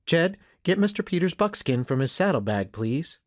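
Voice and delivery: a hint of a brisk mother; mother instructing. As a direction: send the child on an errand. Mother instructing her boy.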